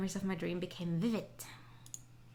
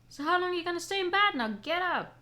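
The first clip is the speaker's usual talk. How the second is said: scolding maid; 7 semitones higher, 4 dB louder than usual. Acted. Voicing a scolding maid character.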